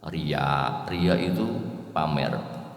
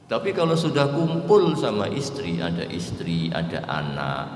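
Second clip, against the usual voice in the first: preaching; 3 semitones higher, 3 dB louder than usual. Real, not acted. Preaching builds on social conduct.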